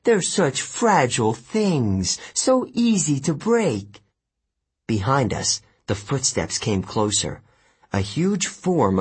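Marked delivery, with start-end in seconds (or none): 0.0-4.0 menacing. Acted. Kelly threatening captive.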